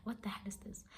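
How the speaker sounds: hushed, whispered disbelief; stunned whisper. Retold or imitated. Girlfriend repeating her reaction to the breakup, stunned whisper. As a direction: whisper it as if to yourself.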